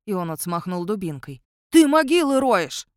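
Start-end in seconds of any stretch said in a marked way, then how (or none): 1.7-2.9 high, loud accusation; angry shout. A narrator's reading. Watchman accuses werewolf of digging graves, angry shout. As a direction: shout it at the culprit, outraged.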